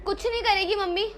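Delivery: ordinary delivery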